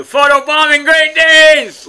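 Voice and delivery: loud, high, stretched-out holler; mock-exasperated shout. Real, not acted. Calling out his Great Danes in the shot, loud holler. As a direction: holler it out at the photobombers.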